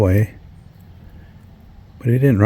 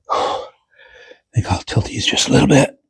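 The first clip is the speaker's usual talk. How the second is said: whispering; 5 semitones higher than usual. Real, not acted. Working in cramped attic.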